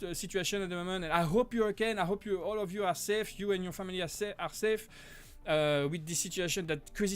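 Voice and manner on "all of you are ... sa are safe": ordinary talk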